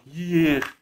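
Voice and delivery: high, drawn-out call. A narrator's reading. Hidden voice calling through the echo, high long call. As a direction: call it out from among the stones.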